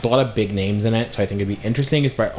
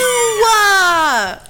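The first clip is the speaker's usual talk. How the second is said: high, loud shriek of laughter; 5 dB louder than usual. Real, not acted. Laughing at her friend's joke, in a high loud shriek. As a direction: cackle way up high.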